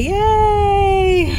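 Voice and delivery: high shrieked fake cheer; sarcastic yay. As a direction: squeal it with mock glee. Sarcastic cheer before extraction.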